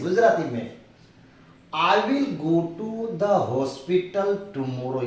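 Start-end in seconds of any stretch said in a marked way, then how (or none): 1.7-5.0 each word spaced out; very slow dictation. Shown as a demonstration. Teacher giving the English line slowly. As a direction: read it word by word. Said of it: "dictating English translation".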